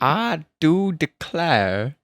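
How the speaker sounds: very slow, stretched-out drawl; attempted drawl. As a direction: copy the drawl, dragging every word. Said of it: "I don't know where to put my draw".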